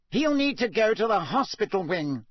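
loud and high official voice; loudly. Acted. Fudge announcing to Dumbledore, loud and high. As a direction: insist loudly over the crowd. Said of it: "Fudge was saying loudly".

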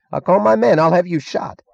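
high, loud snarl; angry threat. A narrator's reading. Cuffy Meggs threatening to have Stadler shot, snarling. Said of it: "snarled Cuffy Meggs".

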